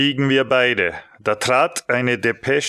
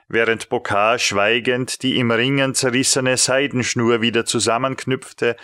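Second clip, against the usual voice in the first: ordinary delivery, nothing marked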